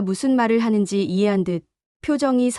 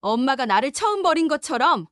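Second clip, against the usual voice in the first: higher, bitter accusation; cold accusing daughter; 6 semitones higher than usual. Acted. Daughter confronting the mother who abandoned her, bitter and higher. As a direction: throw her past back at her.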